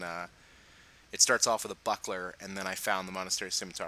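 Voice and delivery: ordinary delivery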